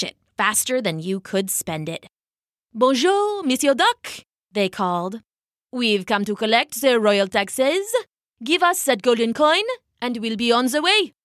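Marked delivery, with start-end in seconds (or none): 2.7-4.2 put-on French-accented tax collector, pitched high. 5.7-11.1 put-on French-accented royal tax collector; collect the taxes in a French accent.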